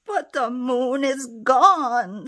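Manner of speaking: loud, high tearful wail; baby bear in tears; sobbing cry. Acted. Baby bear sobbing over the lost moon, loud and high. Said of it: "sobbed a baby bear".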